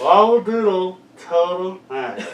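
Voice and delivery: garbled, loud, through a mouth guard; mouth guard speech. Real, not acted. Mouth guard guessing game.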